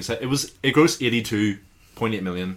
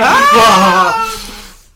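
shrieking laugh; 17 dB louder than usual. Real, not acted.

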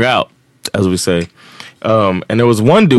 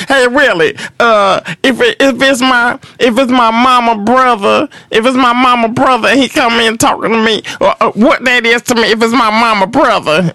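weird high voice; 13 semitones higher than usual. Retold or imitated. Storyteller imitating the neighborhood man asking about relatives, in a high weird voice. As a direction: squeeze the voice up high and drag it out. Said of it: "he had this weird voice".

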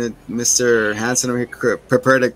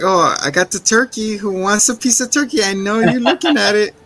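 higher, leering host voice; mock creepy host; 8 semitones higher than usual. Retelling the host offering turkey, higher leering voice. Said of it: "he's like".

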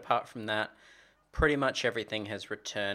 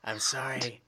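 whispered apology; whispering; 6 dB quieter than usual. Pretend. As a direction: whisper a mock-sheepish sorry. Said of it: "Shh, be quiet".

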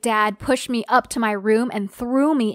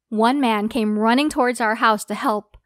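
ordinary delivery

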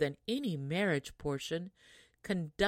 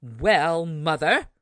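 loud, cheeky reply; pert answer; 11 dB louder, slower than usual. Acted. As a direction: answer back rudely. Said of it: "answered the pert hussy".

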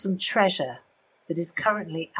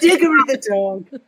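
laughing; 5 semitones higher, 7 dB louder than usual. Real, not acted. Repeating guess, laughing.

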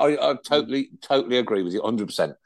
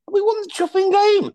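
high, loud and fast burst; gleeful outburst; excited; 5 dB louder, faster than usual. Real, not acted. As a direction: punch out the win triumphantly. Triumphant punchline of the story.

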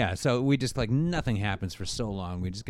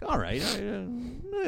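grudging agreement; 14 semitones higher, much slower than usual. Acted. Jerry the elf grudgingly agrees.

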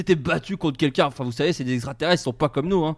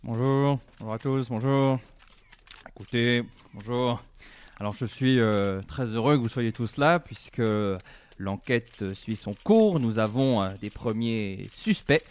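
lower, slow judge at a press conference; pompous judge; 7 semitones lower, 4 dB quieter than usual. Acted. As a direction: address the cameras as a judge. Plays judge Lambert on television.

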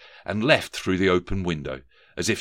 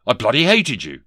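loud, raised, irritable comeback; snapped retort; 5 semitones higher, 7 dB louder than usual. A narrator's reading. Ghost contradicts Scrooge, snappish and loud. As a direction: snap it back at him. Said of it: "snapped Johnson Marley".

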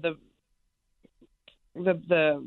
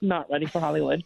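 ordinary talk

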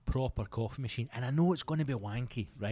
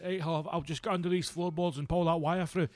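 raised-pitch workman getting on with it; lightly voiced workie, pitched up; tradesman's chipper aside; 5 semitones higher than usual. Workie to whoever handed him a latte, lightly put-on voice.

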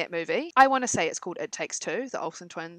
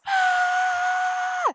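high piercing mock scream; frightened girl screaming at a ghost; scream of fright; 5 dB louder than usual. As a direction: shriek like a startled kid. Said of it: "And then they're like".

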